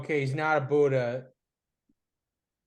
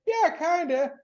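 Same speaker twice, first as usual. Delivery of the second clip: imitating Sean; 9 semitones higher than usual. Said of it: "And he said".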